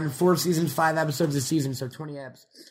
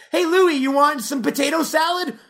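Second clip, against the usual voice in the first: much higher, louder offer; bright offer to Louis; a friend offering Louis potato salad; 11 semitones higher, 3 dB louder than usual. Retold or imitated. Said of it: "all they do is like".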